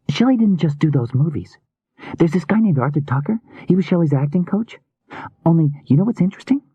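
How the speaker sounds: put-on high, girlish voice, confiding a theory; eager young woman. A narrator's reading. Rune explains her suspects to Healy, eager young woman. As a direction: lay out the clues in a high voice.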